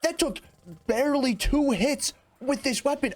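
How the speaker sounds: amazed. Real, not acted. Gamer stunned the enemy fell so quickly. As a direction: marvel at how fast it died. Boss dies in two hits.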